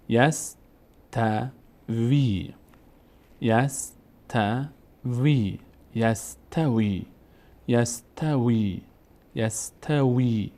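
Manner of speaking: یستوی slowly as yas-ta-vii, last ee held; syllable-by-syllable reading. Shown as a demonstration. Teacher models the example word, slow long-vowel reading. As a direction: break یستوی into drawn-out syllables. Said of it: "اینجا الان صدای کشیده ای داره".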